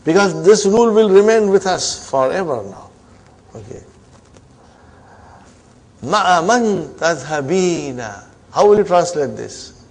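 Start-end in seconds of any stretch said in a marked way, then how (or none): 6.0-8.2 slow model reading. Shown as a demonstration. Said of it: "teacher modelling the sentence".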